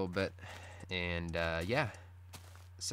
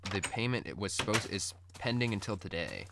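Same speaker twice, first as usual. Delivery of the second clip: ordinary talk, nothing marked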